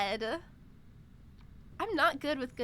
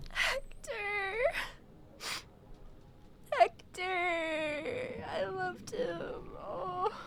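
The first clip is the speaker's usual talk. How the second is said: high, drawn-out tearful wail; crying; 5 semitones higher, much slower than usual. Real, not acted. Streamer saying goodbye to Hector, really crying. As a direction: sob the name, then sink into tears. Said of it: "I'm actually crying".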